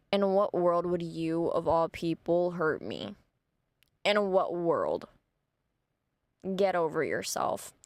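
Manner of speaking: low, slow, deliberate put-down; scornful brush-off. Retold or imitated. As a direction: act out unimpressed disdain. Acted scornful brush-off on phone.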